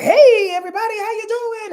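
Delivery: high, loud sing-song hello; excited greeting. Real, not acted. Host greeting her live viewers, excited and high. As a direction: call out a big welcome to the viewers.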